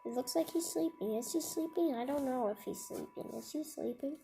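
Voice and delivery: soft, fast hushed asides; hushed wondering. Real, not acted. Reader wondering softly whether the giant sleeps. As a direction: hush so the giant won't wake.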